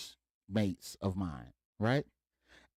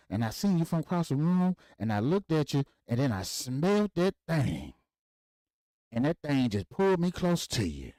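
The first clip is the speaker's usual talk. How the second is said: higher and louder storytelling, a touch of swagger; dad reminiscing; 8 semitones higher, 3 dB louder than usual. Retold or imitated. Father telling the bank lady about a party, higher and louder. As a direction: relive the party like a smooth old player.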